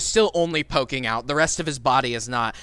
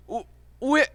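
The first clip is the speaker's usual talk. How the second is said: confused goon; 10 semitones higher, slower than usual. Acted.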